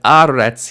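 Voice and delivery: AH-retz, loud first syllable; initial stress. Shown as a demonstration. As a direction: punch the "a" of aretz. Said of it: "initial stress".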